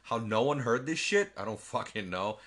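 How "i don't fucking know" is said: ordinary talk, nothing marked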